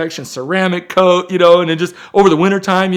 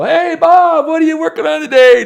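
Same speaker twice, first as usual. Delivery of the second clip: much higher and louder, bright hammed-up greeting; cheesy vlogger hello, pitched way up and loud; mock excited camera host; 14 semitones higher, 9 dB louder than usual. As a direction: play the pumped-up guy with the camera in his face. Friend filming him, mock greeting.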